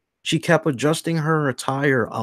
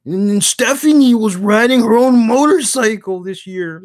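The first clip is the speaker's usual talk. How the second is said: a touch louder, put-on fan voice defending Triple H; hint of a huffy wrestling fan, a little louder; indignant hardcore fan; 8 semitones higher, 6 dB louder than usual. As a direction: argue back like an offended superfan. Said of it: "they're gonna be like".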